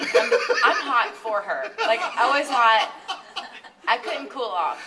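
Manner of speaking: loud, laughing retelling of the heat; breathless giggly storytelling; laughing while telling. Real, not acted. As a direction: laugh your way through the story. Laughing through hot-stage story.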